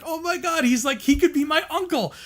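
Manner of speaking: high-pitched retold delight; exuberantly happy. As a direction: gush like a thrilled kid, pitch way up. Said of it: "I was so exuberantly happy".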